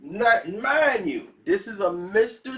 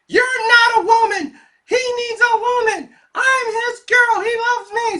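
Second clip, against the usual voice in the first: much higher and louder, a mocking high-pitched girlfriend; high girly taunt; 7 dB louder than usual. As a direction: squeal it like a jealous young girlfriend. Girlfriend confronting Mister T.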